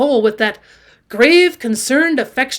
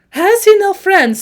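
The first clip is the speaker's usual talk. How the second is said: higher, louder, slow plaintive question; tearful voice; 8 semitones higher, 8 dB louder, slower than usual. A narrator's reading. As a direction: plead softheartedly, close to tears. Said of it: "in a tearful voice".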